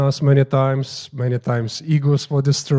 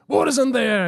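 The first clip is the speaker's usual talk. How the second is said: high, sudden demanding yell; retold yell; 11 semitones higher, 3 dB louder than usual. Guru suddenly squeezing a priest's chest, retold yell. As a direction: imitate a guru's yell in a higher voice. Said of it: "he yells".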